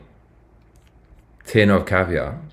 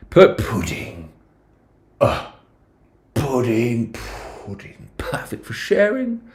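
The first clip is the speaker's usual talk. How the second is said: slow, plummy British menu read, high swoops; posh British menu reading; 4 semitones higher, slower than usual. Pretend. Vlogger reads the dessert menu in a hammed-up posh British accent. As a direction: read the puddings like a posh waiter.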